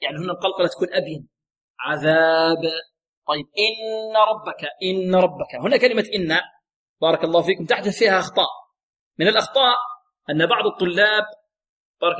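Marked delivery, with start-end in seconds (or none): none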